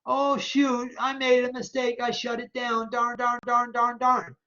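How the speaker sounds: mock dismay. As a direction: play the flustered student, jokingly. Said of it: "I say".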